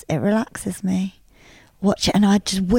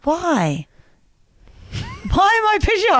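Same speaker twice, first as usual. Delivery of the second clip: ordinary talk, nothing marked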